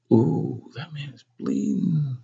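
high, soft, hushed alarm; awed child's thought. Acted. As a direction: think it aloud, high and softly. Boy's shocked thought at bleeding man.

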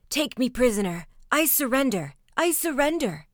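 high, loud panicked surrender; crying out. A narrator's reading. Schultz surrendering to an imagined enemy, crying out. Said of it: "cried Master Schultz".

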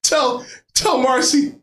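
slow hammed-up last words; bleeding out. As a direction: fake dying gasp of a message.

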